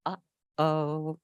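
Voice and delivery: plain reading voice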